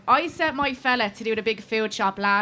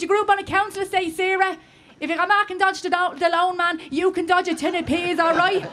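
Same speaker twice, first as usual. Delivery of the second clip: rallying pep talk; 5 semitones higher than usual. Retold or imitated. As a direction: fire her up like a coach. Rallying Sarah.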